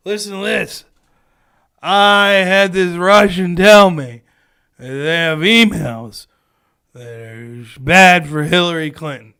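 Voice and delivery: put-on loud, slow drunken bragging; loud, slow drunk voice of a guy spilling a secret. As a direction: slur it out like a drunk at the bar. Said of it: "he's drunk at a bar".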